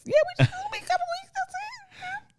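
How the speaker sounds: high, fast rapper impression; [name] voice. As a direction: pitch it way up like a rapper. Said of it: "[name] voice".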